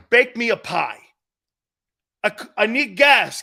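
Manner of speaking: sarcastic and loud, fed up; aggravated. Retold or imitated. Customer snapping at the gas clerk, aggravated. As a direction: snap back at the clerk, annoyed. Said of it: "I'm very aggravated".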